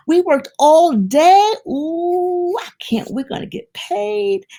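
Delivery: high, giddy voice of hopeful workers; lightly voiced workers getting excited; expectant workers. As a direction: savor the coming payday. Workers expect more pay.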